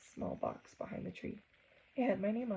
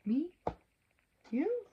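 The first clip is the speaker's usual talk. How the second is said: surprised; 10 semitones higher than usual. Acted. Teller points, playful surprise.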